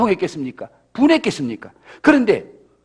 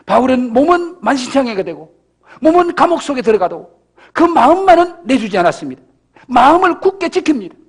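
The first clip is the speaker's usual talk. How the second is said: louder proclaiming sermon; preaching at full force; 3 semitones higher, 5 dB louder than usual. Acted. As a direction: proclaim it with conviction. Preaching climax. (힘차게 선포하는 설교)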